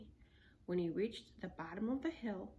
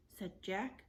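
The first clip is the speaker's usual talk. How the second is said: ordinary delivery, nothing marked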